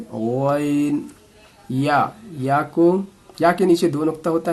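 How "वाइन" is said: वाइन said heavy from the throat; heavy throaty ain. Shown as a demonstration. Teacher demonstrates throaty वाइन. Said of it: "वाइन को मोटी करके बोले हल्क से".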